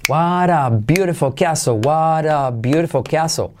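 slowly stretched, linked words; slow model sentence. Shown as a demonstration. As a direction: draw it out for learners to copy. (lento y alargado, para repetir)

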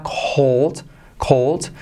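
cold said with a puff of air on the c; cold, breathy aspirated k at the start. Shown as a demonstration. Teacher showing students the aspirated cold. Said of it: "Fíjate esa C cómo se aspira".

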